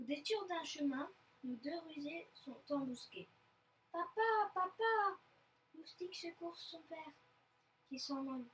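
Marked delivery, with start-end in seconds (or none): 3.9-5.2 high, loud call to dad; child's raised-voice cry. Acted. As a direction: call urgently for your father. Cub calls to father for help.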